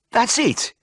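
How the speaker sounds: very high, triumphant cry; thrilled patient hearing the right word; excited exclamation. A narrator's reading. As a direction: burst out in high-pitched Brummie recognition. Patient recognises the word, throws up arm.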